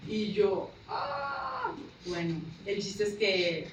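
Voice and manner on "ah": mock horrified wail, very high; high drawn-out "aah". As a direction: let out a long, high "aah". Retold reaction to alarming explanation.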